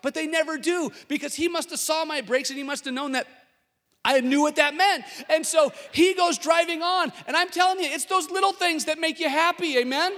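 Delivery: high, loud, fired-up preaching; full-force preaching. Acted. Pastor rousing his congregation, full-force preaching. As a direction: rouse the room and hold it.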